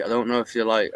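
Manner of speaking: usual speaking voice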